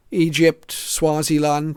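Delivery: ordinary talk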